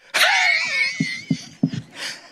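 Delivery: very high, long wheezing laugh; Chico's laugh. Retold or imitated. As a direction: imitate a brother's shrieking, airless laugh. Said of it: "That's how he laughed".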